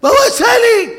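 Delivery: high, loud panicked wail; loud panicked cry; wailing woman. Retold or imitated. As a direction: cry out stricken. Stricken woman wails.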